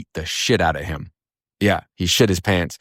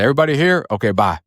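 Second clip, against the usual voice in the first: high-pitched mock call-out to a room; officer's roll call; 7 semitones higher, faster than usual. Officer glancing into the day room, cursory high call-out. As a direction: call out a cursory headcount, then dismiss it.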